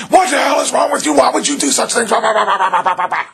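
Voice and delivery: loud, high, furious parent shout; imitated parent yelling. Parent yelling at their kid, furious shout. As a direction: blow up at the kid like an angry parent. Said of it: "They're yelling at you".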